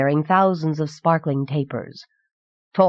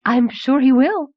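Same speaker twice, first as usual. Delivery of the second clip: high, giddy lilt; 8 semitones higher, 4 dB louder than usual. A narrator's reading. Lady assuring the boy about magic, giddily. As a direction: pitch it up with giddy delight. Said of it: "uttering a giddy little laugh".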